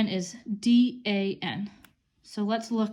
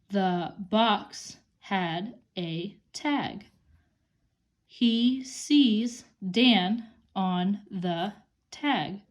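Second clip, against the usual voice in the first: slow, word-by-word reading aloud; reading slowly; much slower than usual. Shown as a demonstration. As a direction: sound out each word for young readers.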